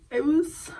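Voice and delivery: choked up, fighting not to cry; holding back tears. Real, not acted. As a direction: try not to cry through the words. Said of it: "I don't wanna cry".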